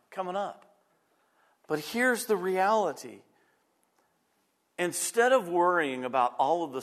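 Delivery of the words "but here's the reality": usual speaking voice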